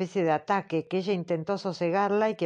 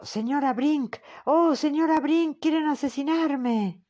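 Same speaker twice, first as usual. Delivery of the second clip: retold scream; 7 semitones higher, slower than usual. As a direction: cry out for the medium in fake terror. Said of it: "Que usted gritó".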